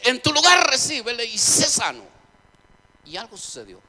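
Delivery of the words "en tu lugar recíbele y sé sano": preaching shout. Acted. Excited preacher retells the healing call.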